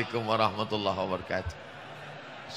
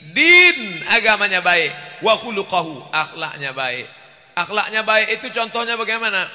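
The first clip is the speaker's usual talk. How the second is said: louder, higher preacher's call; a touch of fiery sermon voice; emphatic preaching; 9 semitones higher, 11 dB louder than usual. Acted. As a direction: proclaim it to the hall. Preaching at full force.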